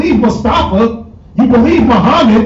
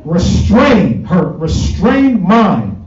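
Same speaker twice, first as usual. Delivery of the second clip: slow emphasis; 4 semitones lower, much slower than usual. Real, not acted. Preacher dwelling on key word.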